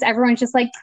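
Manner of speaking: normal talking voice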